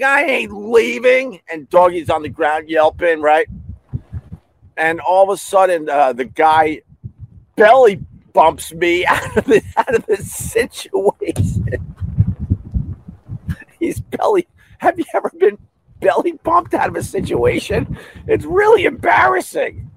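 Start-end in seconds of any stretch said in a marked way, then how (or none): 0.0-1.4 standing his ground against the big man; defiant shout. Retold or imitated. Defiant reply to big guy. 7.5-19.9 high, squeaky laughing telling; laughing at his own humiliation. Real, not acted. Laughing about belly bump.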